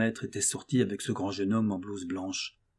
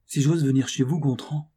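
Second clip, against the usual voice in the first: louder, higher, indignant attack; vehement; 4 semitones higher, 6 dB louder than usual. Acted. Countess confronting Gontran to his face, vehement and loud. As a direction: throw the words at him in fury. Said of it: "reprit-elle avec une véhémence extraordinaire".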